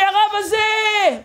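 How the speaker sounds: high, drawn-out shout; mock ecstatic roar; imitated roar. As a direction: bellow it high, stretching the words. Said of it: "איזה שאגה הוא צעק".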